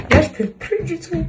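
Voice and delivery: exasperated. Real, not acted. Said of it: "je suis trop énervée".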